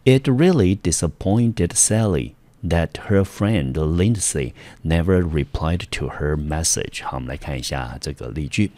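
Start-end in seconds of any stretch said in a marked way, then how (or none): 0.0-7.0 slow, clear example sentence read to a class; teacher reading the example sentence slowly and carefully; slow model reading. Shown as a demonstration. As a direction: read it out slowly for learners to follow. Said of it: "我们直接来看例句".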